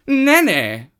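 very high, loud haughty no; a proud cat refusing. Acted. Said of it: "said the cat".

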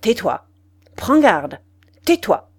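short, loud threats, spaced apart; repeated warning. A narrator's reading. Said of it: "Il répéta".

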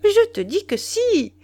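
high, loud, leaning on "je"; impatient insistence. Acted. Wife insisting to Duroy, impatient and loud. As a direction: insist sharply, pressing hard on "je". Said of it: "haussait les épaules avec impatience".

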